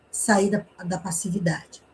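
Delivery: ordinary talk, nothing marked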